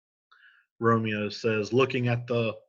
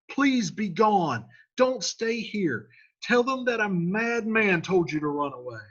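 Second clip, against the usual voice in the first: desperate Romeo; 10 semitones higher than usual. Retold or imitated. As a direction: beg him to leave. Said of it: "So Romeo's like".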